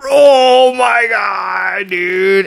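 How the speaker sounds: huge high yell, drawn out slow; excited shout. Real, not acted. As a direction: shout it out in pure awe. Seeing Godzilla's foot come down.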